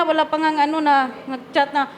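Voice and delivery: ordinary talk, nothing marked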